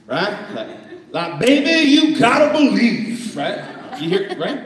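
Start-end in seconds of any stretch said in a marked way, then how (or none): 1.4-3.4 high, loud, slow soul-singer delivery; Southern soul voice, loud and stretched; southern soulish. Shown as a demonstration. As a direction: belt it like a Southern soul preacher. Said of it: "say it with a southern, soulish feel".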